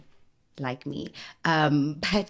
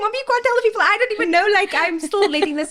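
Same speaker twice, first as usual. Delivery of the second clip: high, loud frantic plea; hammed-up flustered objection; acted panicked protest; 6 dB louder, faster than usual. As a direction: replay a daughter squealing in alarm at mom. Acting protest to blabbing mom.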